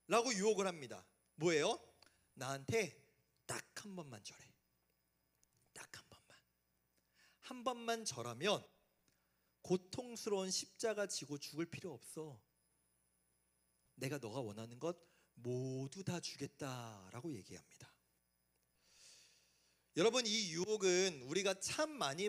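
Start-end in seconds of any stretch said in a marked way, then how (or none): 2.3-17.3 soft, creeping voice of the tempter; a devil murmuring an offer close to the ear; hushed devil whisper. Acted. 17.4-17.9 narration dropped to a whisper; faded, whispered telling. Acted. Narration whispered after quote.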